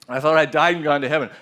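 normal talking voice

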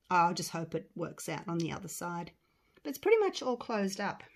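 usual speaking voice

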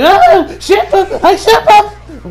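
high, loud laughing squeal, slowed; hammed-up fan shriek with laughter; fangirl squeal. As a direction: squeal like a shipper losing it. Fan squeals over hand-holding.

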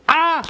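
short, high mock yelp; quick, high-pitched "ah"; mock alarmed "ah". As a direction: pretend to be horrified. Mock alarm at chemical pizza.

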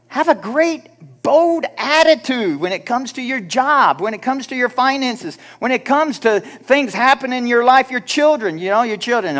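high, loud, ringing exhortation; fiery preaching. Acted. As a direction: preach it at full power. Preaching at full force.

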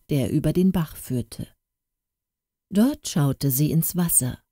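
ordinary delivery

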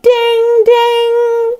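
high sung ding-ding like a bell. Acted. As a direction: chime it like an alarm bell. Boy rings the alarm bell.

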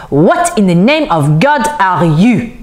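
slow, appalled disbelief; put-on voice of a horrified little man; mild theatrical gasp. A narrator's reading. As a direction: draw out the question in affronted shock. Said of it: "he gasped".

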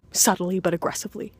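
usual speaking voice

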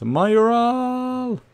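name shouted high and loud; excited shout. Real, not acted. Gamer shouting as his striker shoots at goal, excited shout. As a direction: yell the name as the chance flies. Striker's shot goes over.

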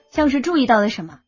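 usual speaking voice

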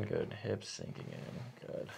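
slow near-whisper; tingly asmr mouth sounds. Real, not acted. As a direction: hush it out gently. Soft coaching during treatment.

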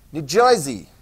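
"New Jersey" as New Joy-zee in a light Jersey accent; a quick Jersey accent on "Jersey". Shown as a demonstration. Teacher jokes about how locals say it, light Jersey accent. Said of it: "Do they talk like New Jersey".

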